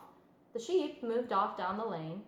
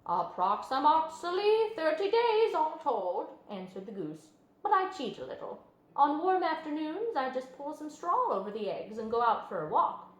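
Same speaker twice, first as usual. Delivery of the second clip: goose voice; 6 semitones higher, 4 dB louder than usual. A narrator's reading. Goose explains hatching.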